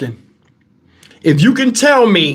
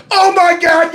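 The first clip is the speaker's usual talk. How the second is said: laughing exclamation; 7 semitones higher than usual. Real, not acted. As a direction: burst out in laughter. Laughing outburst.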